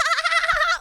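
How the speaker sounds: high, over-the-top fake giggle; fake flirty laugh.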